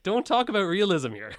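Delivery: loud, high joking outburst; hammed-up shout at the fans; mock outburst. Laughing mock outrage at fans.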